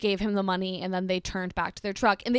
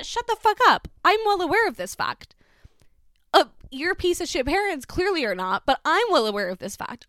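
high, louder exasperated snap; inner outburst; 7 semitones higher, 4 dB louder than usual. Retold or imitated. Babysitter's unspoken reply to the boy, exasperated. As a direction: vent the thought she held back.

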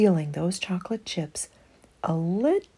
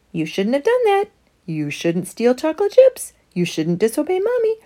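higher, louder firm scolding; scolding conscience; 8 semitones higher, 6 dB louder, faster than usual. Acted. Conscience telling Emma she did wrong, firm raised scolding. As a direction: scold her like a stern inner voice.

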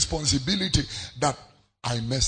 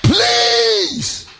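preaching shout; much slower than usual. Real, not acted. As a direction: hurl it at the congregation.

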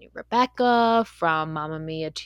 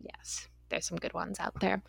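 soft unvoiced whisper; 14 dB quieter than usual. Real, not acted. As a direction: drop to a whisper. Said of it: "whispered aside".

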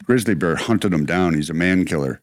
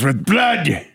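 tough Hopkins; 8 semitones higher than usual. Retold or imitated. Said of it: "He goes".

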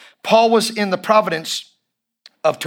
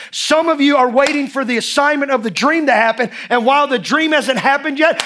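urgent, pitched-up sermon drive; impassioned preaching; 6 semitones higher than usual. Real, not acted. Preacher urges church to preach in the streets, impassioned. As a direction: drive every phrase home to the congregation.

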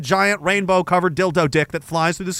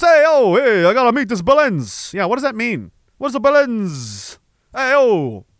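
mock British accent; 5 semitones higher than usual. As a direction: play a rowdy British lad. Mimicking British slang speaker.

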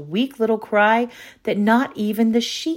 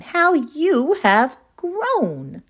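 grandmother's voice; 9 semitones higher, much slower than usual. A narrator's reading.

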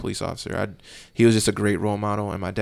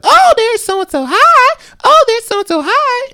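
very high, loud sweet greeting; grandma greeting people; 16 dB louder, slower than usual. Retold or imitated. Grandson imitating his grandma greeting everyone in public, high and loud. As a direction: greet everyone you know in town. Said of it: "she would just be like".

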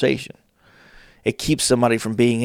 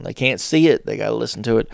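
ordinary delivery

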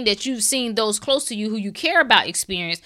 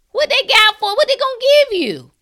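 much higher and louder, ranting; heated mock question; 13 semitones higher, 7 dB louder than usual. Real, not acted. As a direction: fire the question off indignantly. Mocking rant about doctors.

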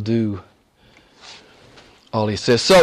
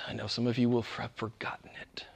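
hushed breathy aside; whisper; 3 semitones lower, 16 dB quieter than usual. Real, not acted. Preacher confides to the congregation, whispering. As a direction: let it slip out quietly.

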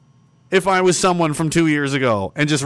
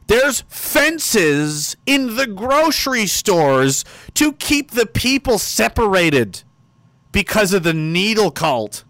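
slow emphatic; 4 semitones higher, slower than usual. Real, not acted. Stressing absurd news.

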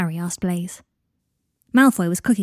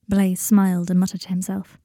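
plain reading voice

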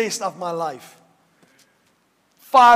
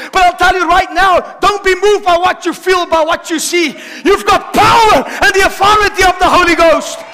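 higher and much louder, near shouting; shouting preacher; 9 semitones higher, 10 dB louder than usual. Acted. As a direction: whip up the congregation with every line. Preacher building to shouting climax.

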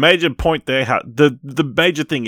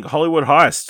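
ordinary talk, nothing marked

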